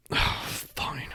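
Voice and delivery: a soft, breathy grumbled "Oh, fine"; annoyed mutter. Retold or imitated. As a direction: mutter it under your breath, fed up. Annoyed at dropped receipt.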